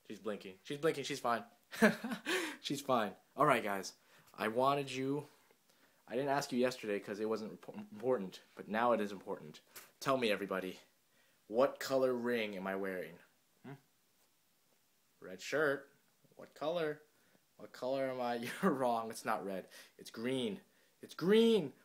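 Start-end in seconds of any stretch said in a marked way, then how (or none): 1.7-2.6 high, loud burst of laughter; real high laugh. Real, not acted. Laughs at the baby's antics. 21.1-21.7 high, loud, triumphant announcement; excited, raised-voice reveal. Real, not acted.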